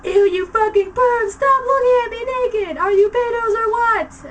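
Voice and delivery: high, loud disgusted shriek; screaming. A narrator's reading. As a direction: shriek at the peeping perverts. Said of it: "I screamed".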